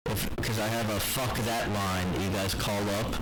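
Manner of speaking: loud, defiant mock call-in rant; ranting radio host. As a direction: rail at listeners at full volume.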